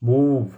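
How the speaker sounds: मूव with the oo held long; long oo vowel. Shown as a demonstration. Teacher giving an example word, मूव with lengthened oo. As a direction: draw out the vowel inside मूव. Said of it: "sound थोड़ा लंबा हो जाएगा".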